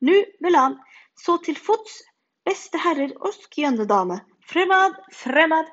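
loud, high heroic call to arms; rally the friends like a brave knight; knight's rallying cry. A narrator's reading. Knight rallies the children, loud heroic call.

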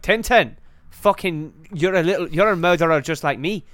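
angry Scottish sea captain; hammed-up Scots accusation; Captain Haddock. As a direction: accuse him in a rough Scottish accent. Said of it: "then Captain Haddock's like".

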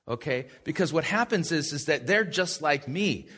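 normal talking voice

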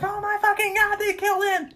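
very high squealed horror; mock panic. College kids seeing the cop impaled, mock panic.

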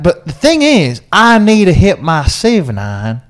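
loud, high Southern American twang; southern American accent. Retold or imitated. Golfer complains to Bruce about his seven iron, in a loud Southern American accent. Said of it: "is [name]'s southern American accent good or bad".

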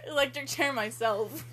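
ordinary delivery

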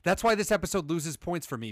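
ordinary talk, nothing marked

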